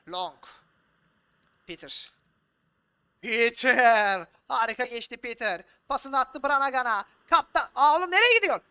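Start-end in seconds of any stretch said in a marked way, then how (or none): 3.2-8.7 loud, high yelling at the players; excited commentary. Real, not acted. Manager yells at his captain running the wrong way. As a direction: shout at the screen. Live match commentary, attack.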